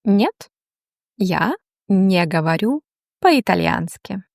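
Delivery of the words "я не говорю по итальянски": ordinary delivery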